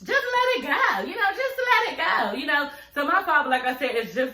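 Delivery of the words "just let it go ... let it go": mocking men. Woman mocking what men love to say, high and drawling. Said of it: "But men love to say".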